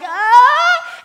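high, loud laughing yelp; a short scared laughing shriek; startled yelp. Retold or imitated. Scared on ride lift.

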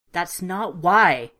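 loud, slow, emphatic protest; angry outburst. Acted. Petra bursting out at the narrator, loud and slow. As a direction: burst out, dragging each word. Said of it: "Petra burst out".

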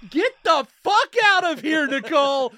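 high, very loud comic tirade; mock furious yell. Doctor throwing out the patient with two days, mock furious yelling. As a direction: scream them out of the office.

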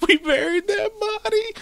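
very high, squeaky mock sob; hammed-up tearful wail; mock crying. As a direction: imitate a crying old man in a tiny high voice. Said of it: "starts crying".

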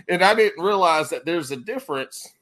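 ordinary delivery

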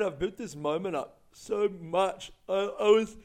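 talking through a yawn. Real, not acted. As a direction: yawn while speaking. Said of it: "I wonder why I keep yawning".

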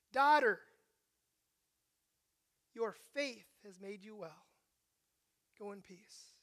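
Jesus tenderly sending the healed woman away; tender blessing. Retold or imitated. Jesus gently dismisses healed woman.

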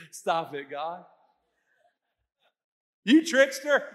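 laughing, teasing God as a trickster, loud on the last words; laughing mock protest. Preacher to congregation, mock-scolding God, laughing loudly. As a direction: giggle through a playful complaint, then burst out. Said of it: "we'd be like, laughing".

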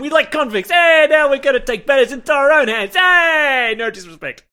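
put-on loud, high-pitched, over-the-top Brooklyn accent; Brooklyn guy. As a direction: mouth off like a cartoon Brooklyn loudmouth. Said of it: "like, a Brooklyn guy".

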